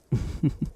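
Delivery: soft unvoiced giggle; breathy laugh. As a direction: laugh quietly to yourself. Amused at the pet's reaction.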